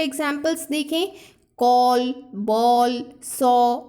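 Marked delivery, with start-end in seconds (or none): 1.6-3.8 'कॉल, बॉल, सॉ' louder, each with a rounded aw vowel; demonstration words. Shown as a demonstration. Teacher modelling the aw sound of A for students, louder. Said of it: "यहाँ पर भी हम ए ही use कर रहे हैं".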